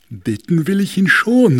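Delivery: loud, high blustery voice; north wind. Acted. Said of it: "sagte der Nordwind".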